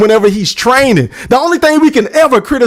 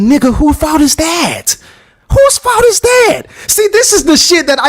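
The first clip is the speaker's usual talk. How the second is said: incredulous retort; 6 semitones higher than usual. Acted. Throwing a boxer's excuses back at him, high incredulous retort.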